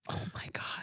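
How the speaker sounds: breathless, hushed whisper; shocked whisper. Retold or imitated. Reading that he was shot dead, a stunned whisper.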